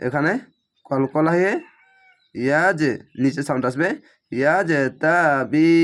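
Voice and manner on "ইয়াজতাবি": ইয়াজতাবি slowly, final বি held long; slow recited ইয়াজতাবি; Quranic recitation. Shown as a demonstration. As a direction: stretch the ending of ইয়াজতাবি. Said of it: "এক আলিফ টেনে পড়বেন".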